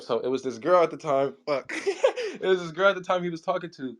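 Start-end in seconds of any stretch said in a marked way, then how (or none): 1.8-2.4 brief high laugh; short burst of amused laughter. Real, not acted. Storyteller amused at his own slip, quick laugh.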